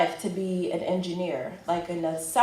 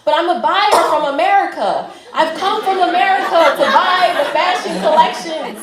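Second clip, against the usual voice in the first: much higher and louder, laughing through a desperate plea; 9 semitones higher, 8 dB louder than usual. Retold or imitated. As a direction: laugh while begging to be let in. Pleading with doorman, laughing.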